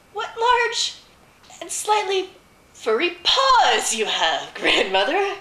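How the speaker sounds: high, loud, drawn-out wonder; astonished girl. A narrator's reading. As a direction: stretch it out high, with growing amazement. Girl marvels at furry paws.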